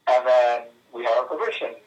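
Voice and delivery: ordinary delivery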